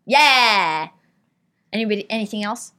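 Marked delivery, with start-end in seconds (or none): 0.1-0.9 loud burp on command; word formed on a burp. Shown as a demonstration. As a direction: belch the word out long and loud. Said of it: "I can burp on command".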